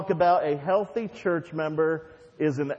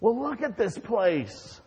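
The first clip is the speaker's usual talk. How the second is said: exasperated boss; 6 semitones higher than usual. Acted. Owner scolds assistant over chaos.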